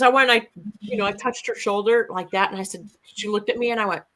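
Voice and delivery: ordinary talk, nothing marked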